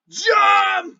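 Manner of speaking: high, drawn-out yell; heroic shout. Acted. Game master as Balthazar leaping off, full shout. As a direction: belt it out as a battle cry. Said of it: "as he shouts".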